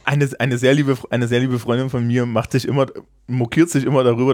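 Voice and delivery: ordinary talk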